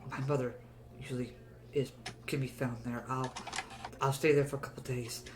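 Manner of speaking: soft, shaky whispered thanks; grateful hushed voice. Acted. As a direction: whisper thanks, still frightened. Freed prisoner thanks party.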